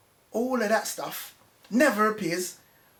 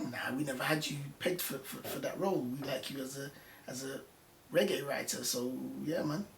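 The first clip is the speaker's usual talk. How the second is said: low, soft retold reply; hushed, offhand quote in a lower pitch; casual brush-off; 5 semitones lower, 11 dB quieter than usual. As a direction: imitate an editor letting someone down, quietly. Acts out the editor's dismissive reply.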